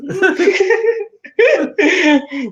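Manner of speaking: loud high burst of laughter; a real, loud, high laugh; big laugh. Real, not acted. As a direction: crack up at the teasing. Laughing at posh teasing.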